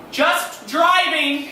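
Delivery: high, loud, drawn-out mom voice; strained mom reply. Retold or imitated. Mom telling the kids where dad is going, strained and loud. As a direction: stretch it out slowly, high and loud.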